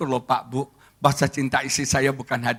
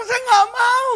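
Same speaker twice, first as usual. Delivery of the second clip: very high, raised protest; mock whining refusal; 5 dB louder, slower than usual. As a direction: squeal the refusal like a reluctant husband. Husband refuses to go along.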